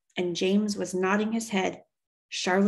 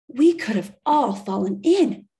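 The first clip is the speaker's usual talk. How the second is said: gasped; 5 semitones higher, 6 dB louder than usual. Acted. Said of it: "one woman gasped".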